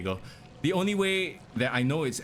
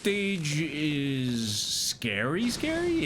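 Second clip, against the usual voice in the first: spooky voice; 3 semitones higher, much slower than usual. Pretend.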